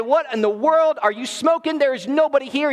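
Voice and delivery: usual speaking voice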